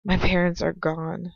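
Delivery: plain reading voice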